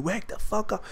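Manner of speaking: high-pitched but softened mock yell; retold scream, held back. Brother waking him, retold as softened high yell. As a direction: replay the brother's yell at reduced volume. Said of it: "just screaming, saying".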